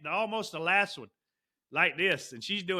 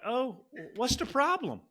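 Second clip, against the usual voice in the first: higher, slow mock-oblivious mom; clueless mom; 7 semitones higher, slower than usual. Imitating the mom at the golf carts, clueless. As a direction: lightly imitate a puzzled, innocent parent. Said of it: "the mom was just looking at me like".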